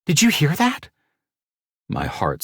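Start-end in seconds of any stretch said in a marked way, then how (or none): 0.0-0.9 amazed woman. Acted. Mercedes amazed by the baby.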